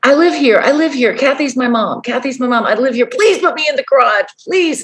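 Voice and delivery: high, frantic begging; desperate pleading. Retold or imitated. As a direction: plead to be hidden right now. Young man pleading for shelter.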